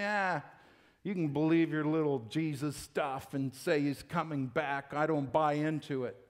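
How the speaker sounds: low, dismissive brush-off; mocking scoffer. Mocker dismissing a believer's faith, low and scornful. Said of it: "he addresses people that are mocking right now".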